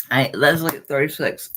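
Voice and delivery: ordinary talk, nothing marked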